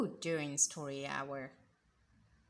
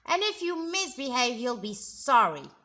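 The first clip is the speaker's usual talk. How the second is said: fast, loud warning; threatening teacher; 7 dB louder, faster than usual. Acted. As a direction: threaten the class. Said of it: "said Miss Swamp".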